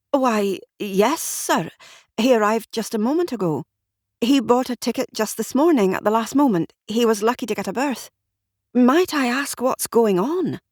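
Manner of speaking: lightly voiced nervous clerk; a hint of a fussy official; flustered clerk voice. Flustered clerk answers detective.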